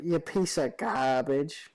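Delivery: put-on slow, mild Jersey accent; lightly voiced Jersey tough guy, taking his time. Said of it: "That's Jersey".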